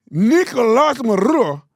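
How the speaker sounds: high, loud, overdone Spanish "Nicolas Maduro"; mock Spanish accent. Host mimicking the reporter, exaggerated Spanish accent. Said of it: "Just do it in Spanish".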